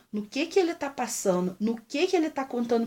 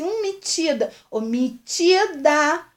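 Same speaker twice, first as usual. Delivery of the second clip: higher, slow, drawn-out sneer; mocking stuck-up jab; 5 semitones higher, 4 dB louder, slower than usual. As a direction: sneer at the child with a stretched-out metida. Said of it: "E a outra falou assim".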